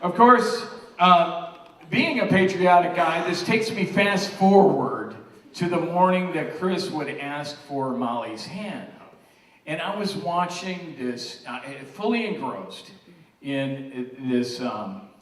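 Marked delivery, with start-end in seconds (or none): 0.0-8.9 loud, proud toast to the room; rally the room with pride; father of the bride speech. Real, not acted. Toasting family members at the wedding.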